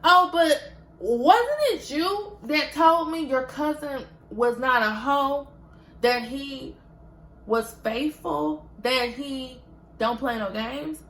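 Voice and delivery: spring the trap, sarcastic and loud; challenging the friend with her own words; sarcastic gotcha. Retold or imitated. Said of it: "I said".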